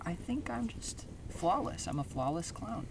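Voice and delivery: soft, high self-satisfied clown; lightly voiced vain clown; mildly prideful. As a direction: quietly admire yourself as you say it. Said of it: "getting a little bit prideful".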